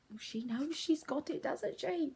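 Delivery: ordinary delivery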